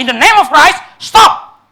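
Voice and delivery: high, loud cry to be heard; imitated shout. Monk shouting over the noisy stadium crowd, loud imitated cry. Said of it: "dia teriak lagi".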